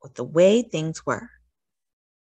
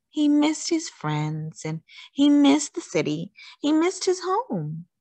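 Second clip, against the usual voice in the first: wistful; 9 semitones higher than usual. A narrator's reading. Lonely tiger missing home.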